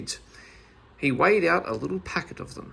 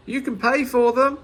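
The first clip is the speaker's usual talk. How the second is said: high, loud taunting shout; gleeful shout; 10 semitones higher, 6 dB louder than usual. A narrator's reading. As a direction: yell it while laughing at him. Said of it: "he shouted".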